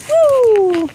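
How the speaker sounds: loud high whoop; excited woo. Real, not acted. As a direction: cheer the jump loudly. Dog jumps.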